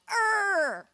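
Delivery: put-on a little kid's playful high "Arr!"; toddler's growl. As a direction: roar it like a three-year-old playing pirate. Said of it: "Arthur just went".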